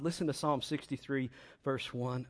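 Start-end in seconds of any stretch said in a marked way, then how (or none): none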